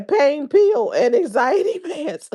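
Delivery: high, drawn-out, laughing delivery; breaking into laughter. Real, not acted. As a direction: crack up partway through the phrase. Amused retelling of gossip.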